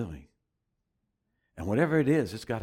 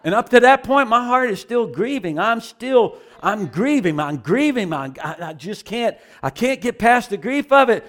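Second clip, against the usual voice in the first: intense retelling; 9 semitones higher, 8 dB louder than usual. Real, not acted. Recalling grief while prophesied over.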